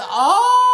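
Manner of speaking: loud, very high "oh!" of scandalized delight; shocked whoop. Retold or imitated. Reacting to a castmate's drug money jab, loud gleeful shock. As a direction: shriek it like the comeback just landed. Her retold reaction to the comeback.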